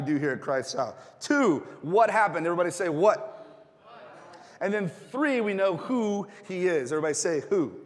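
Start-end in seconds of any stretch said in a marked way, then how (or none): none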